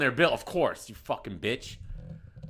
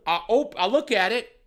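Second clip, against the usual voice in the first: ordinary talk, nothing marked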